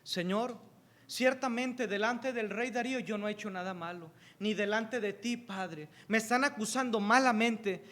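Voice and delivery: soft, pleading prayer to the Father; gentle murmured prayer; quiet prayer. Acted. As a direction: pray softly as Daniel in trouble. Voices Daniel's prayer.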